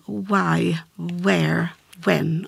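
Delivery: "why", "where", "when" with a rounded English w; slowly spaced English words showing the w. Shown as a demonstration. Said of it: "det låter lite grann som engelskans".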